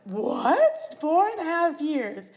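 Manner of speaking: high-pitched, retold astonishment; taken aback. Hearing how long they've been together, taken aback. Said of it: "I was fully taken back".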